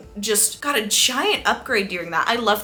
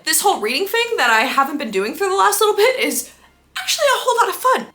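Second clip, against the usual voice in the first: much higher and louder, laughing fast at the end; delighted realization; 10 semitones higher, 5 dB louder than usual. Real, not acted. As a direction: let the joy of discovery bubble over into a laugh. Retelling a sudden happy realization.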